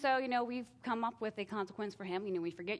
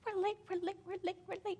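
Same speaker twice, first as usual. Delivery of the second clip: higher, hurried we're late chant; frantic inner panic; 11 semitones higher than usual. Retold or imitated. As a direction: rush it like a panicking mom. Rushing kids to school.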